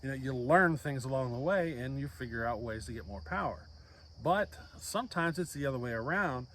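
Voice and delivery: ordinary talk, nothing marked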